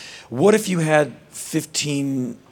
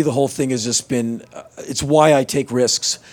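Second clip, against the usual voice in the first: ordinary delivery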